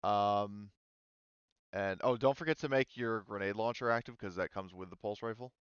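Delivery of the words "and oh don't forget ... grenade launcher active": ordinary delivery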